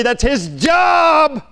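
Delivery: high, drawn-out shout on "job"; emphatic preaching shout. Real, not acted. Preacher insisting a father provides without begging, emphatic shout. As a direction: cry it out, stretching the last word long. Preacher driving home his point.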